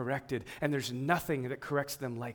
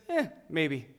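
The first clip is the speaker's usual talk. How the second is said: mildly indifferent, noncommittal; dismissive shrug. Retold or imitated. Shrugging off someone's promise to do something, mildly dismissive. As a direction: shrug it off casually. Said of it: "a really lighthearted view of a promise".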